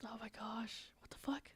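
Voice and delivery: shocked breathy whisper. Real, not acted. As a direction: whisper it in disbelief. Shocked at game scene.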